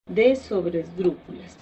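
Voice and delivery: usual speaking voice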